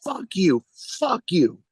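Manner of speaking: high-pitched mock yelling; repeated heckler yell. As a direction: imitate a heckler shouting on repeat. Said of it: "he keeps repeating it".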